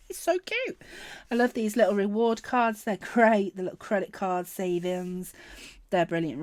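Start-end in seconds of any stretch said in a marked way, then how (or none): 0.1-0.8 cute coo. Real, not acted. Cooing at the pig sticker.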